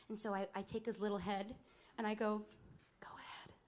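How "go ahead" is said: hushed whisper with the mic off; secret whisper. Retold or imitated. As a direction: whisper it furtively to the child. Secret urging with mic off onstage.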